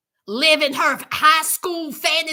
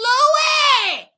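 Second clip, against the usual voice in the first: high, exasperated holler of a name; calling out; 7 semitones higher, 4 dB louder than usual. Retold or imitated. Viewer calling out to Louis, exasperated holler. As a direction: holler his name in the retelling.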